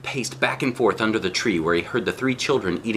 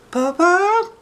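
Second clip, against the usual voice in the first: very high, loud questioning call; 5 dB louder than usual. Acted. Shang calls to the fallen wolf, high and loud. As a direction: call down to check if he's alive. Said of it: "Shang shouted".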